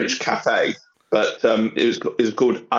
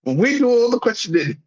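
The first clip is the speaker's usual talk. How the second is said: high stern interrogator voice; mock interrogator; 9 semitones higher than usual. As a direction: play a bossy questioner.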